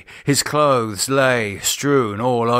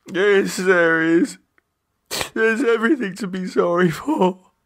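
despairing; 9 semitones higher than usual. Acted. David despairing.

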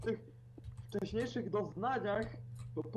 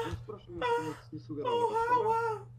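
whiny protest; 6 dB louder than usual. Real, not acted. Defends his earlier noises.

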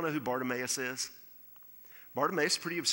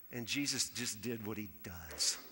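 ordinary delivery

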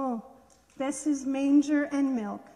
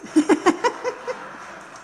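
real laugh, rising and breathy; bright laugh; 6 semitones higher, 6 dB louder than usual. Real, not acted. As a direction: laugh freely after the story ends. Teacher laughs after the story.

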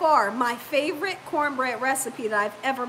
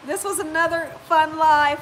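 ordinary talk

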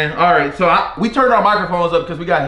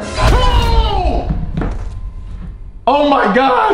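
startled shout; 10 semitones higher, much slower than usual. Real, not acted. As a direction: yelp at the sudden scare. Granny appears suddenly.

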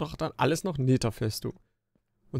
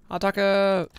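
battle cry; 8 semitones higher, 4 dB louder than usual. Real, not acted. Charging at the mobs.

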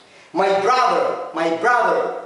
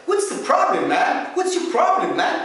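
higher, American movie tough-guy "What's your problem, man"; 8 semitones higher, 3 dB louder, much slower than usual. Shown as a demonstration. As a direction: challenge someone like a movie character. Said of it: "شوف الأفلام الأمريكية". (تقليد أمريكي من الأفلام)

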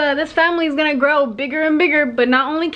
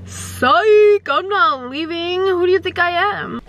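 triumphant psych; 4 semitones higher, slower than usual. Real, not acted. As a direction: shout it gleefully. Reveal prank shout.

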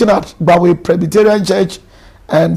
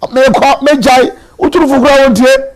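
angry spouse voiced; 7 semitones higher, 4 dB louder than usual. Voicing an angry spouse leaving.

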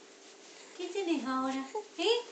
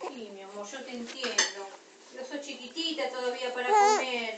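much softer, low and tender; soft comforting; 5 semitones lower, 3 dB quieter than usual. Real, not acted. Comforting hungry baby.

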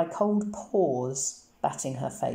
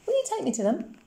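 put-on high, curious girl's voice; Coraline asking; 11 semitones higher than usual. A narrator's reading. Child Coraline asks the cat.

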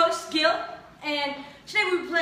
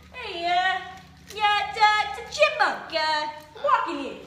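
hammed-up New Yorker, pitched up; comic New York street-guy accent; 5 semitones higher, slower than usual. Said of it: "in a New York accent".

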